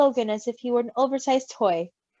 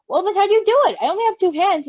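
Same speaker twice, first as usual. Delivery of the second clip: exasperated protest; 8 semitones higher, 5 dB louder, faster than usual. Acted.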